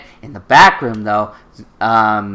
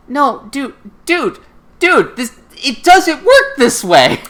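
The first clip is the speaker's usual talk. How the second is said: high, emphatic mock protest; exasperated pleading; 10 semitones higher, slower than usual. Reasoning with people like Suzaku, high exasperated plea. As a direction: plead with a naive friend.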